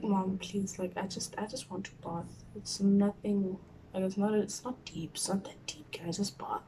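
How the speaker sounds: quiet, weary pleading; soft plea. Retold or imitated. Crying daughter asking mom to let her bathe, softly. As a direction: ask softly, worn out.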